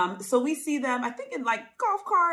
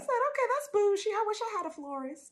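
pitched way up, impressed and a little shady; high sassy quip; 12 semitones higher, 3 dB quieter than usual. Retold or imitated. Cohost retelling her reaction to the florist, high and sassy. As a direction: tease it like a jealous friend. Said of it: "I said".

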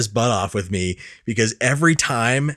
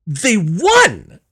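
higher, a mock exasperated they won; retold exasperation; 7 semitones higher, 4 dB louder than usual. Son retells arguing with his dad, mock exasperated. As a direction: plead the obvious in mock disbelief. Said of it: "I went".

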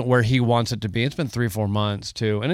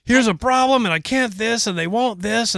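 mocking own whining; 11 semitones higher, slower than usual. [name] mocks his own meeting whining.

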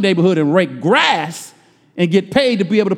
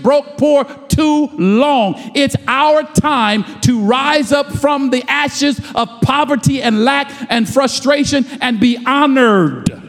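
higher, slow, drawn-out preaching cadence; full-force preaching; 5 semitones higher than usual. Real, not acted. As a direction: preach it, building to the call.

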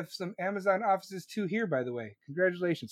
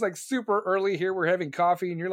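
usual speaking voice

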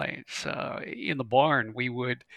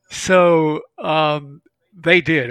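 normal talking voice

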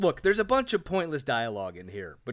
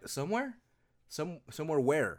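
confused; 5 dB quieter than usual. Retold or imitated. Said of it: "that just sounds like he's confused to me".

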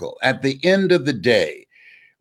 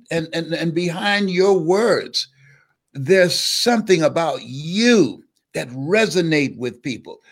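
rising sermon; 4 semitones higher than usual. Acted. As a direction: lift them up like a preacher. Preaches to the audience.

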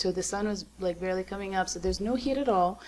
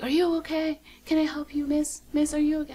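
high-pitched worried questions, retold; concerned helper; 9 semitones higher, 3 dB louder than usual. Medic tent guy to sobbing runner, concerned. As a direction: imitate a helper fussing over someone upset.

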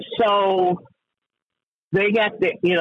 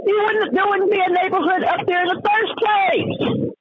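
high-pitched indignant rant; worked-up, exasperated tirade climbing high; yelling; 11 semitones higher than usual. Real, not acted. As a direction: raise your voice in outraged disbelief. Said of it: "am I yelling".

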